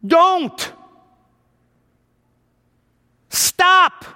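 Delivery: sharp parent's don't and stop; stern parent. Retold or imitated. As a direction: scold the kids like a parent. Parent scolding the kids.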